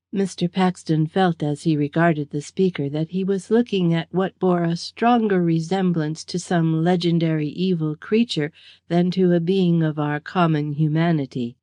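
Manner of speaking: ordinary delivery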